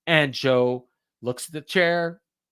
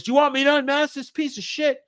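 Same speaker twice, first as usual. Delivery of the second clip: much higher-pitched mock taunt; imitated wrestler's taunt; 13 semitones higher, 3 dB louder than usual. Wrestler asks fans about unmasking his opponent, high mock taunt. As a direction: act out a wrestler working the crowd, pitched high.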